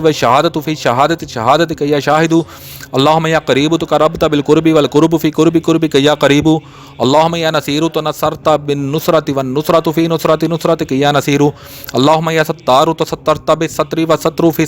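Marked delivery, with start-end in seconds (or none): none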